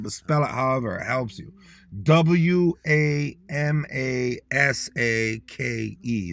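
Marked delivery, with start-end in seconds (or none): 2.0-6.3 letters spelled out slowly one by one; W A M A S A K, each letter spaced out; slow spelling. Shown as a demonstration. Spelling the word slowly for listeners. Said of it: "I'ma spell that the way I spell it".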